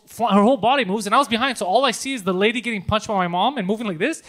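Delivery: normal talking voice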